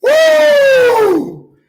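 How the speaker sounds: very high shouted woo; hyped whoop. Real, not acted. Hyped host cheering the game with a whoop. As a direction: let out a wild whoop. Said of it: "I'm extra loud right now".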